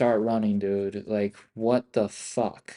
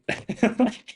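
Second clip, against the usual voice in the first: laugh; 12 semitones higher than usual. Real, not acted. Laugh of disbelief.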